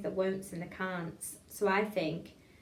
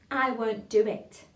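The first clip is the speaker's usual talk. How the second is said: slow, careful diction; tongue-twister demo; 5 dB louder, slower than usual. Shown as a demonstration. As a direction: say it slowly for a class to copy. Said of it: "Next line".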